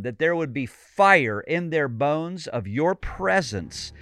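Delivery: loud, fervent intercession; fervent prayer. Acted. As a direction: pray it out boldly. Closing prayer at full force.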